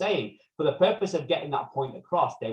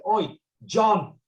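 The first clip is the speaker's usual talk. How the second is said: raised, higher-pitched mock call; calling the waiter; 7 semitones higher, 4 dB louder, slower than usual. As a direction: hail a waiter loudly, as in a joke. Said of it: "they called the waiter".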